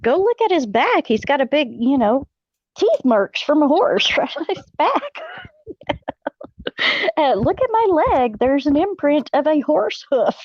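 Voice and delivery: high, laughing insistence; insisting. Retold or imitated. Wife urging ER staff to look at the wounds, high laughing insistence. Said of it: "I'm like".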